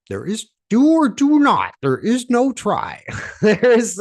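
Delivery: high, loud hammed-up Yoda impression, slowing on no try. Coach doing a bad Yoda for the audience, high and hammed-up. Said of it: "I'm gonna use my best Yoda voice here".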